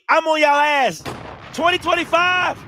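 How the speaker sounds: high, loud trash talk; gloating, loud rallying cry; loud taunting. Real, not acted. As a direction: yell the threat at the rival team. Threatening rival team, hyped.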